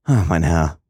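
mildly exclaimed, as a narrator would; emotional exclamation. A narrator's reading. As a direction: exclaim it with a touch of emotion, falling away. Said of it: "rief die junge Frau aus".

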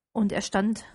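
ordinary delivery, nothing marked

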